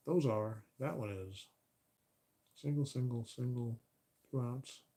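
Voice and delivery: normal talking voice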